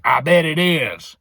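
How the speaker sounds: drawn-out, loud mock uncle voice. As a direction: imitate a heckler outside the door. Uncle taunting outside the bathroom.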